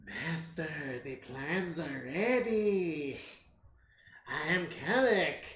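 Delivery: slow, soft, creepy servant voice; Peter Lorre impersonation. Retold or imitated. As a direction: purr it like a sinister henchman. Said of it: "the Peter Lorre impersonation".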